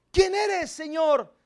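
high, pleading cry of fear; frightened high cry. Acted. Saul on the ground crying out high to the Lord. Saul asks, fallen, retold again.

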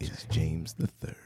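low, whispered announcer intro. Acted. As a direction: hush it like a ring announcer. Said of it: "my announcer voice".

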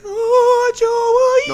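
very high, loud comic wail; hammed-up high-pitched movie impression; movie character's wail. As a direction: belt it out in a drawn-out falsetto. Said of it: "and he's like".

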